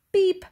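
high, loud little beep; truck beep. A narrator's reading. Blue greets the farm animals, bright high beep. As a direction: toot it like a toy truck horn. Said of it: "said Blue".

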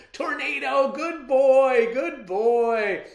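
high, loud cries of delight; excited yelling. Acted. Child greeting the returned dog, overjoyed yell. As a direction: praise the dog at the top of your voice. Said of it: "I yelled".

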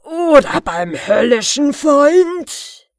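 loud, slow, high threat; angry threat. Acted. The fiend threatens the dwarf with hell. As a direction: growl out a slow menace. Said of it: "brüllte der Unhold".

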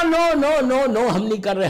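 high, loud protesting no; loud denial. Acted. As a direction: cry out in denial. Crowd denies loudly.

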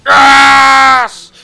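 high, very loud drawn-out yes; triumphant scream. Real, not acted. Gamer celebrating a kill, triumphant scream. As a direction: scream in victory.

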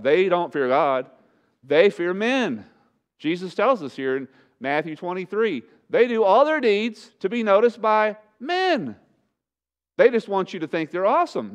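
high, loud and driving pulpit fire; preaching at full force. Acted. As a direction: preach it hard, slowing to pound each line.

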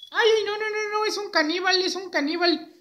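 high-pitched panicky puppet; mock alarm. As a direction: squeal a playful accusation as a puppet. Muñeco acusa alarmado de caníbal. (alarma fingida con voz aguda de muñeco)

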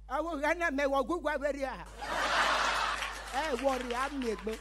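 high-pitched put-on old man voice; old man's threat. Chief priest warning the comedian who mocked him, high put-on voice.

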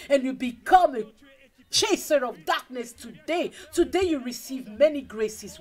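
full-force preaching. Real, not acted. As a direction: proclaim it over the congregation, building to an amen. Declares blessings over congregation.

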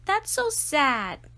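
mildly sad; a touch tearful. A narrator's reading. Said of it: "tearing up".

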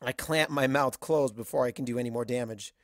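ordinary delivery

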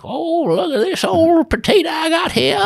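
high, drawn-out put-on old woman's voice; old lady voice. Retelling a comic's supermarket bit, imitated old lady voice. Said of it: "he does a voice where he's like".

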